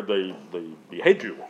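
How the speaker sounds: ordinary delivery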